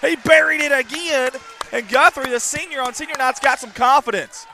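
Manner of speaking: announcer yelling over the crowd; excited. Real, not acted. Excited call of made shots.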